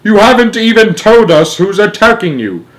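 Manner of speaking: high, loud exasperation; yelling. A narrator's reading. President demanding who attacks his astronauts, yelling. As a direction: yell down the radio. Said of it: "yelled the president".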